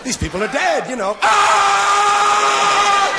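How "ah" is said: very high, loud mock scream of grief. Shown as a demonstration. Comic demonstrates a moment of screaming, very high loud scream. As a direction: let out a full-throated scream. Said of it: "How about a moment of screaming?".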